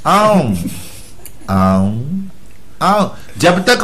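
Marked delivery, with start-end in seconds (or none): 1.5-2.0 much lower, slow chewing grunt; deep lazy chewing noise; mock slow chewing sound. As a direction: mimic a player chewing lazily. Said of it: "ये चबा रहा धीरे धीरे".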